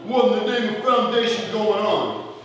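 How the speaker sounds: put-on New York accent; mock New York delivery. Shown as a demonstration. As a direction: say it like a New Yorker. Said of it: "like you're from New York".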